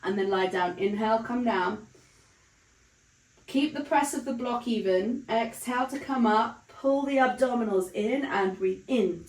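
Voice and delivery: ordinary talk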